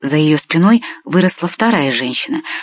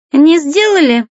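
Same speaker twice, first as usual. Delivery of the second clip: high, louder mocking echo; mocking incredulity; 11 semitones higher, 4 dB louder, slower than usual. A narrator's reading. Natalya mocks the visitor's claim of innocence, high and derisive. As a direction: throw it back in a high, derisive voice. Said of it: "захохотала Наталья".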